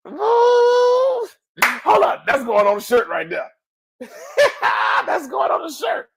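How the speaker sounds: high, loud whoop breaking into laughter; delighted outburst. Real, not acted. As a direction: crow over your own line, laughing. Delighted by own catchphrase.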